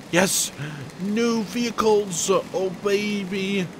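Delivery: slow, high, drawn-out joy; delighted. Real, not acted. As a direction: savour the discovery. Finds the new vehicles.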